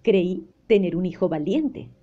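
ordinary delivery, nothing marked

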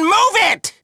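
high, urgent yell; shouted order. Acted. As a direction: push them forward with a shout. Commanding the salvage team.

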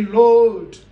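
loud calling out Lord; a loud call to the Lord, opening a prayer; cry out to God. Retold or imitated.

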